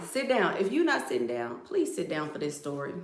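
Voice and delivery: normal talking voice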